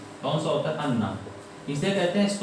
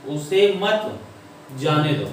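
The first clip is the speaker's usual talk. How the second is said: "मत" raised, a break, then "जाने दो" falling; pause after मत; much slower than usual. Shown as a demonstration. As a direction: stop after "मत" before finishing. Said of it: "यहाँ पर रुके". ("मत" के बाद रुककर "जाने दो")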